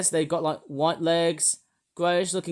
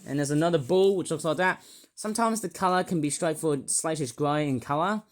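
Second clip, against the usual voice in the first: ordinary talk, nothing marked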